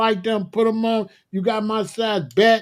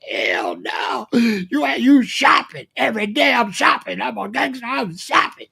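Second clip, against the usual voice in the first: mocking rant; 4 semitones higher than usual. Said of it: "I was like".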